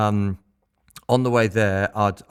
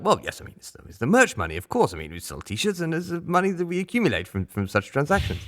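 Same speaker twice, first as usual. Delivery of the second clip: prim English explanation; 8 semitones higher than usual. Retold or imitated. Tim explaining merch money politely.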